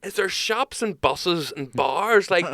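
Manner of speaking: put-on high, loud rambling drunk questions; drunk punter. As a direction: pitch it up and slur through the list like a rubbered regular. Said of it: "he goes".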